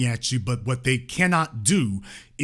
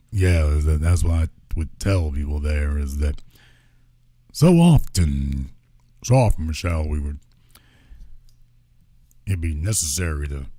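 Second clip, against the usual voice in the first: much lower, dragged-out parody voice; mock Barack Obama; 10 semitones lower than usual. Mocking the former president on the podcast, much lower and rambling. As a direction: sink low and ramble like a sleepy podcast guest. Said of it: "He's talking lower and slower".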